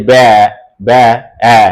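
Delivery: "ব্যা" held, then its bare vowel "অ্যা"; isolated vowel. Shown as a demonstration. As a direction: draw out "ব্যা, ব্যা, অ্যা" with gaps so the open æ is heard. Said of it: "উচ্চারণটা আমাকে কানে শুনতে হবে".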